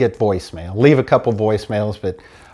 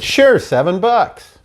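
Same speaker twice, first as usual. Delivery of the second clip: breezy receptionist; 7 semitones higher, slower than usual. Retold or imitated.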